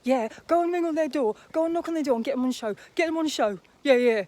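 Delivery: loud, eager mock of a careless host; mocking Alan. Mocking a YouTuber he dislikes, loud and eager. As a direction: bark it like a greedy showman. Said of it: "Alan Vinnicom says".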